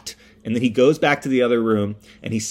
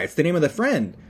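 normal talking voice

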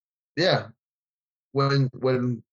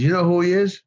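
higher, calling out a question; teasing the crowd; 7 semitones higher than usual. Retold or imitated. Speaker on stage quizzing the crowd, raised voice. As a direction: build suspense for the audience. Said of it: "He says".

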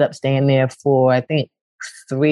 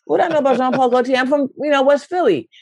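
incredulous high voice; 10 semitones higher than usual. Acted.

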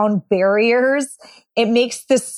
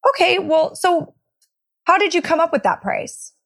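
higher, easygoing curious question; 7 semitones higher than usual. Shown as a demonstration. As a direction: sound curious, never challenging. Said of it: "here is number two".

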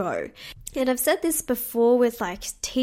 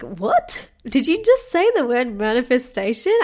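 louder, incredulous retold question; retold shock; 6 dB louder than usual. Speaker retells her reply to the saleswoman's manifestation remark, shocked. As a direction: act out being stunned by what you heard. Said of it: "I was so shocked".

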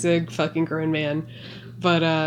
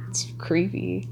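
whisper; 2 semitones lower, 7 dB quieter than usual. Real, not acted. Whispered reaction to the scene.

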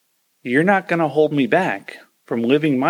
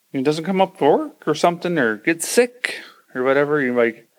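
ordinary talk, nothing marked

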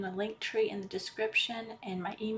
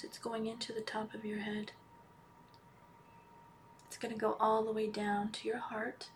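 guided meditation; 8 dB quieter than usual. Acted. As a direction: ease them into calm, almost whispering. Leads a healing meditation.

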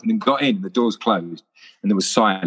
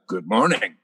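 Sean Connery voice. Retold or imitated. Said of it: "You just can't help it".

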